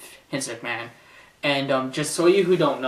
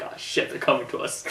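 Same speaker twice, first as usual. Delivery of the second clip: whispered, panicked aside. Retold or imitated. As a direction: hiss it in a panic. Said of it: "He's like".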